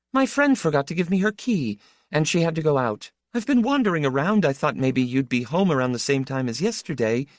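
high, quick woman's voice; high-voiced woman making her excuses; woman from Sorg, chatty. A narrator's reading. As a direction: explain yourself quickly, pitched high.

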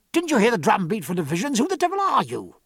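rapid, rising outburst of anger; angry cry. A narrator's reading. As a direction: challenge a stranger in an angry, high-pitched rush. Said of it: "cried Jack".

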